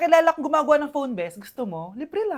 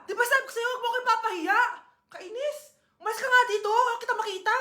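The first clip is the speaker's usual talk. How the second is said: annoyed daughter; 6 semitones higher than usual. Acted. Daughter ashamed snaps at mother.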